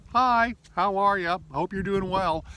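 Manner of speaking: high, bright-voiced fake cheer; mock chirpy text greeting. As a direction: chirp it out like a hopeful hello.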